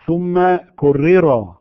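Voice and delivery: كرر said very slowly with the r rolled; drawn-out trilled r. Shown as a demonstration. Teacher reciting the poem line to show the trill. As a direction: stretch كرر and let the r trill. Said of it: "قال".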